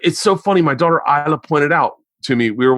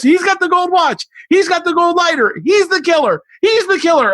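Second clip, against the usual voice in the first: put-on high, eager kid insisting over the movie; excited daughter; 14 semitones higher than usual. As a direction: imitate a daughter blurting out the killer, much higher. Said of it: "talks over the whole thing and goes".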